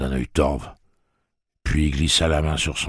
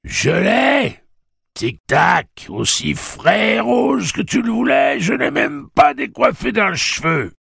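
high, loud triumphant bawl; full-voiced, high-pitched yelling; 8 dB louder, slower than usual. A narrator's reading. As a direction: holler it into an intercom. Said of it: "et brailla".

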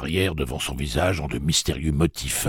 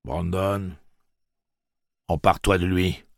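raised, firm command; giving an order; 5 semitones higher than usual. A narrator's reading. Tic-Tac ordering Brandon to seize Gâcheur, commanding. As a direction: order your man to seize him. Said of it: "ordonna Tic-Tac".

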